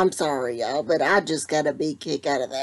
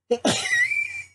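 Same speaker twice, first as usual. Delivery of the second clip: high squealing laugh bursting out; can't hold the laugh in; burst of laughter. Real, not acted. Laughing at a family joke.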